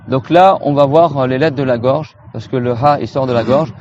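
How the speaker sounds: ordinary delivery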